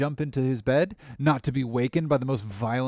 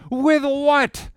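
sharp shout, much higher and louder; 13 semitones higher, 7 dB louder than usual. A narrator's reading. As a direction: fling the question out in a shout. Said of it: "shouted I".

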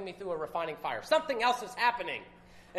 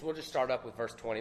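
normal talking voice

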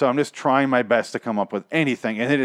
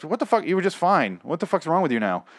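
higher, baffled mock outrage; 7 semitones higher than usual. Paul confused by Katrina's condition, mock outrage. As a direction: sneer it in disbelief. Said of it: "And he's like".

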